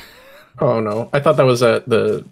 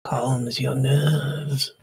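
whispering; 5 dB quieter, much slower than usual. Pretend. Lantern lit, eerie game moment.

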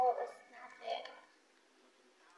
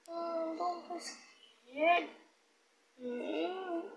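soothing a pet; 5 semitones higher, 3 dB quieter, much slower than usual. Real, not acted. Soothing the frightened dog.

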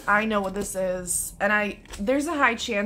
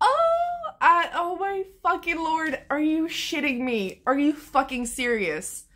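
high, excited disbelief; overwhelmed squeal; 7 semitones higher than usual. Real, not acted. Vlogger seeing the book design, squealing with excitement. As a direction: squeal it out, can't believe it.

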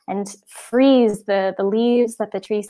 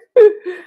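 sob; 9 semitones higher, 6 dB louder than usual. Acted. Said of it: "looked up from the tears".

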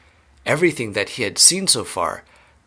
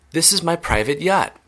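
higher-pitched proud announcement; delighted cry; 6 semitones higher than usual. A narrator's reading. Wonka showing off his boat, delighted cry. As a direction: show it off with beaming pleasure, pitched up. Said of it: "cried Mister Wonka, beaming with pleasure".

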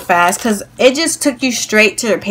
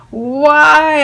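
higher, mock-dismayed cry; drawn-out, retold wail of disbelief; exasperated why; 6 semitones higher, 4 dB louder than usual. Acted dismay at online queue.

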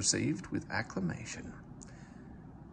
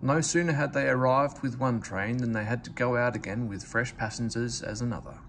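plain reading voice

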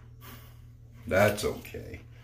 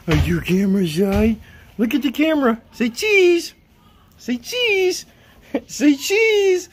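coaxing pet voice; 7 dB louder, slower than usual. Real, not acted. Coaxing the dog to pose.

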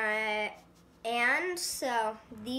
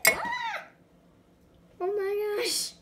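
startled yelp; 11 semitones higher, 4 dB louder, slower than usual. Real, not acted. Mishap with the bread.

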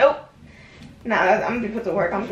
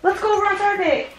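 higher, mock-excited friend; imitating an excited friend; 8 semitones higher than usual. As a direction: play the eager friend. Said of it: "Then I was like".

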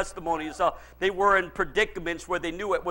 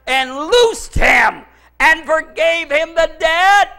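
high, loud, slow scripture shout; triumphant proclamation; 8 semitones higher, 8 dB louder, slower than usual. Real, not acted. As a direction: proclaim the verse slowly at full voice. Preacher proclaims the verse triumphantly.

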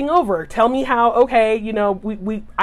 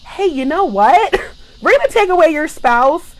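sarcastic hammed-up announcement of deployment; mock military notice; 5 semitones higher, 5 dB louder than usual. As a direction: play the cheery bureaucrat breaking the bad news. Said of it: "that bomb just drops and says".

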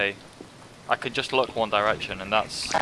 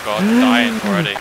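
panicking gamer; 9 semitones higher, 5 dB louder than usual. Real, not acted. Gamer panics mid-match.

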